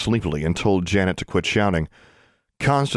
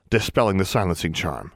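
ordinary delivery

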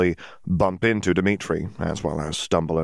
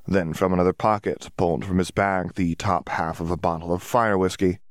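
ordinary delivery, nothing marked

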